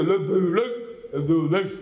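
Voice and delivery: slurred and gummy, the consonants dropped; toothless mumble. Retold or imitated. Preacher mocks toothless God again, slurred mumble. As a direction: mumble it as if you had no teeth. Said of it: "a mouth and no teeth".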